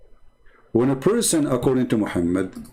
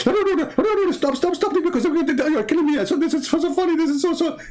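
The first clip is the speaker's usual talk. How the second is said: high squeaky pleading voice; silly falsetto no no no stop; mock begging. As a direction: play a god overcome with giggles. Mock Allah laughing, begging stop.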